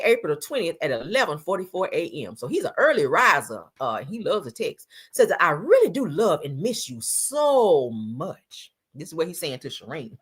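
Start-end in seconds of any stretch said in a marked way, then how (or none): 5.4-8.7 slow, drawn-out mock devotion; put-on romantic pleading; mock lovesick. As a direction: stretch out "so much" in hammed-up longing. Reads his love text dramatically.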